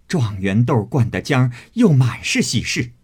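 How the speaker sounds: loud bitter sneer; mock her angrily; angry mockery. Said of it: "指着他骂道". (愤怒地挖苦)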